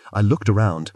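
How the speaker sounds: ordinary delivery, nothing marked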